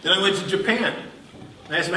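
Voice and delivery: ordinary talk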